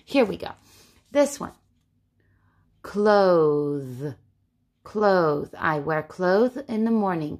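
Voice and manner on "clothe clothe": CLOTHE drawn out, ending on a buzzing th; voiced TH. Shown as a demonstration. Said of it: "Clothes makes the th".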